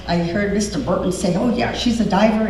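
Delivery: ordinary talk, nothing marked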